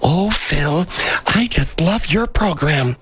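put-on high-pitched older lady fan; high, drawn-out doting old lady; elderly female listener. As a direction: put on a high old woman's voice, gushing to the host. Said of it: "They're elderly female listeners, and they're all like".

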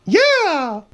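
high excited yell; excited cheer. Acted. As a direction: whoop it up. Announcer cheer.

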